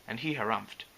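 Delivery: ordinary delivery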